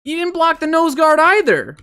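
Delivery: very high-pitched, incredulous cry; high, strained outburst of frustration; exasperated high yell. Real, not acted. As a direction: wail the complaint in disbelief. Discovers another missed block.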